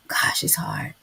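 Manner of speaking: hushed, heartfelt whisper; sympathetic whisper. Real, not acted. Sympathizing with a struggling young woman, whispered. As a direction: whisper it with compassion.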